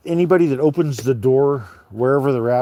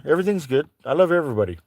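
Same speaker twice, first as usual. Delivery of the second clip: normal talking voice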